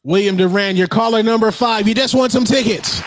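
high, bright prize announcer voice; radio contest promo. Pretend. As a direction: hype the winner like a radio DJ. Host plays a radio prize announcer.

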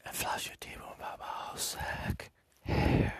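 soft whispered taunt; mock whisper. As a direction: whisper it close to the mic. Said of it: "whispered taunt".

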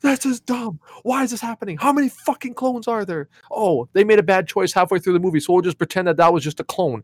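high-pitched fed-up rant; exasperated rant. Retold or imitated. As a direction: rant at the movie, indignant. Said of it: "I was so mad".

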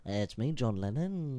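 slow, put-on Liverpool accent. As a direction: draw out the name in mock Scouse. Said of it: "it kinda sound like [name] a little bit".

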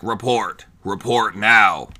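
slow, heavy robotic command; robotic voice changer voice. Acted. Man in mask ordering a report, slow robotic voice. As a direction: drag out the order like a masked machine voice. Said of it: "But in his voice, he's like".

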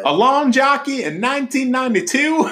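incredulous quote. Retold or imitated. Quoting a line, voice shifted.

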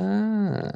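long, high-pitched "ahh" of understanding; realisation "ah". Retold or imitated. Learner retelling when it clicked, mock dawning "ah". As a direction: draw out a rising "ah" as it clicks.

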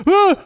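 high startled yelp; retold scream. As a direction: shriek as the bee lands. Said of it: "And I was like".

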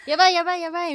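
high loud panicky cry; panicking star. Pretend. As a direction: yell in mock panic. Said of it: "みたいな".

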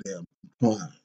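ordinary talk, nothing marked

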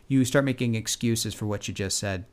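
ordinary talk

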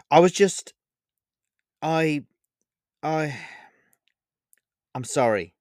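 stammering nervous apology. Acted. Vega apologises to hurt Olivia, nervous stammer. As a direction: trip over the words, caught out. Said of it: "replied Vega nervously".

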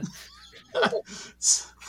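real burst of high laughter; laughing at the jump scare. Real, not acted. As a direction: crack up after the scare.

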